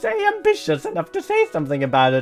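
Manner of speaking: hammed-up fake Italian accent with leaping pitch; terrible Italian accent. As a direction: put on a badly faked Italian accent. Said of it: "Use a terrible Italian accent".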